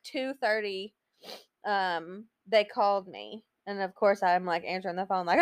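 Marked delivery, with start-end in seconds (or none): none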